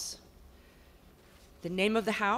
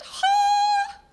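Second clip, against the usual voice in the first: very high, very loud triumphant cry; high-pitched blast; 11 dB louder than usual. Acted. Portrait shrieks to the house about the present. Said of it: "she let out a high-pitched blast".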